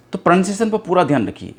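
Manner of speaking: ordinary delivery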